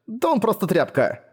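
exasperated high outburst; indignant outburst. A narrator's reading. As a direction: pitch leaps up in scorn. Mia angrily complaining about Quen.

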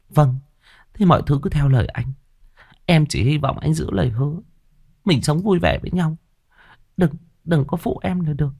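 high-pitched, submissive woman's voice; pleading female lover. Acted. Woman asking her lover to keep his promise, pleading. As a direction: agree sweetly, high and pleading.

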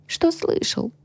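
high, curt, mildly grumpy retort; grumbled. A narrator's reading. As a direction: throw it back grumpily. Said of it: "буркнула я". (буркнуть в ответ)

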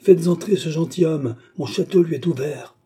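put-on old baroness eagerly orders the knight let in; calling out orders. A narrator's reading. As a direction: fling open the castle with excitement. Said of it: "cria-t-elle".

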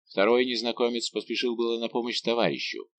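ordinary delivery, nothing marked

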